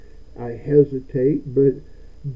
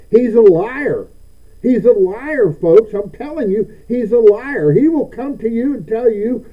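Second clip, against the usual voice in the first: preaching; 7 semitones higher, 6 dB louder than usual. Acted. Pastor preaching at full force.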